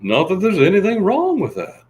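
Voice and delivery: high, loud sitcom whine; Jerry Seinfeld impression. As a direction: deliver it like a neurotic sitcom comic. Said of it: "That's my Jerry Seinfeld".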